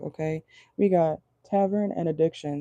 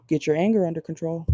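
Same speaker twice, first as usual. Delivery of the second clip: ordinary talk, nothing marked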